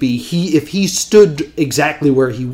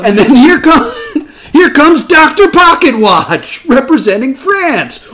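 laughing announcer; 9 semitones higher, 4 dB louder than usual. Pretend. Announcing a mock magician act.

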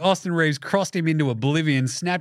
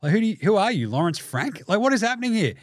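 ordinary talk

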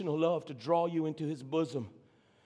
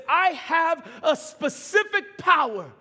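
high, loud, stretched-out declaration; full-force preaching; 10 semitones higher, 9 dB louder than usual. Real, not acted. Preacher declaring Jesus' power over death, full-force preaching.

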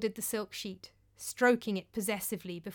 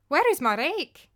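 sharp demand; 6 semitones higher, 7 dB louder than usual. Acted. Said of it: "asked Thorgunna".

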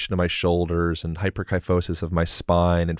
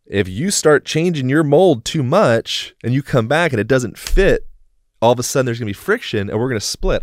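louder, higher, emphatic warning; warning spouse; 5 semitones higher, 5 dB louder than usual. Acted. Spouse warning partner about changing, urgent. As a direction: build the warning, then rush the consequence.

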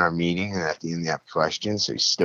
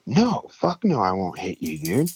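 angry refusal; 2 semitones higher, slower than usual. Retold or imitated. Refusing a friend who asks to be injected, angry. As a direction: act out an outraged no, jumping up in pitch. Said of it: "I'm fucking pissed off at you".

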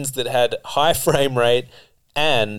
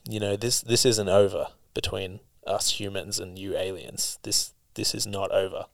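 a much softer, low villain threat; menacing villain; 3 semitones lower, 9 dB quieter than usual. Retold or imitated. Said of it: "he just goes".

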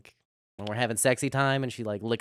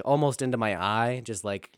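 ordinary delivery

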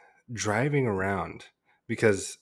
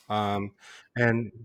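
usual speaking voice

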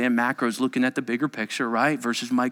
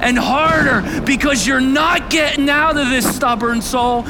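high, loud, driving preaching; impassioned pulpit shout; preaching at full force; 13 semitones higher, 8 dB louder than usual. Real, not acted. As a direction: drive it home with raised intensity.

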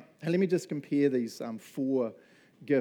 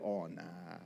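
dismissive brush-off; 7 semitones lower, 10 dB quieter, much slower than usual. Retold or imitated. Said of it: "and then you go".

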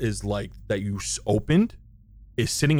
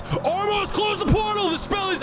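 high, urgent shout to close the portal; woman's urgent cry. Acted. Woman warning the summoner across the room, high and urgent. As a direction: call out to him in a high woman's voice. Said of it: "you hear a woman's voice".

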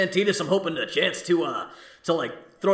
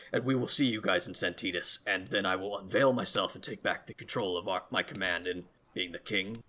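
quiet, grave royal resolve; solemn king; 7 semitones lower, 8 dB quieter than usual. Acted. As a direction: speak as a deposed king reclaiming his throne. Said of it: "what he says".

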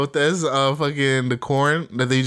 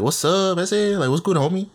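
higher-pitched imitation of Chicano street talk; Chicano English cadence; 5 semitones higher than usual. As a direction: lilt it like a Chicano homie. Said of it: "the way they speak English".

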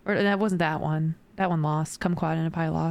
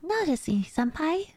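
put-on high, cutesy anime girl plea; overacted anime dub, up in the high notes; 9 semitones higher than usual. Shown as a demonstration. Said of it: "what are some of the lines they have you practicing".

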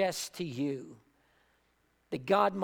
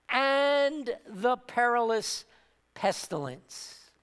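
usual speaking voice

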